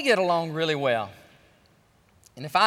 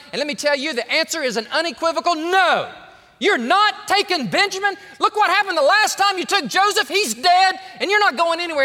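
stern refusal; 8 semitones higher, 5 dB louder than usual. Acted. Jacob refusing his sons.

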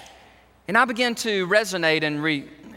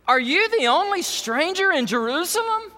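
sarcastic disciple; 9 semitones higher than usual. Retold or imitated. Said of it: "a tint of sarcasm here".

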